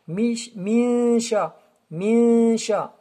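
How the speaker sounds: "min" held long as a nasal hum into "sha"; nasal ghunna. Shown as a demonstration. Teacher demonstrates the runna, "min" hummed through the nose. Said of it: "avec une runna ici".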